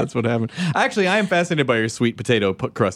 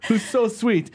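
put-on Italian accent; 8 semitones higher, slower than usual. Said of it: "I'm like".

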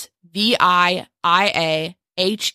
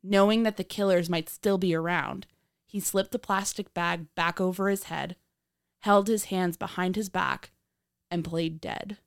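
true crime narrator; 10 semitones higher than usual. A narrator's reading.

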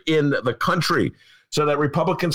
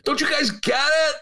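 fed-up outburst at lawmakers; exasperated shout; 14 semitones higher than usual. Retold or imitated. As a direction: yell it up high at thick-headed leaders. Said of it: "And I'm like".

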